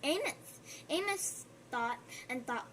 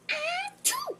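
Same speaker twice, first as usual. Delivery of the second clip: high, loud acted sneeze; 5 dB louder than usual. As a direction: act out a big sneeze. Amos sneezes in the story.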